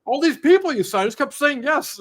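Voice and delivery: excited friend. Retold or imitated. Friend explaining the crowd of cars.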